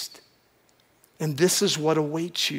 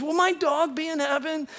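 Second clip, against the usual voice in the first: high, drawn-out mock wail; imitated pleading pet owner; 10 semitones higher than usual. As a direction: plead plaintively, hamming up a worried pet owner. Imitating anxious pet owners' questions.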